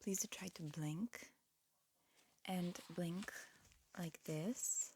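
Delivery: ordinary talk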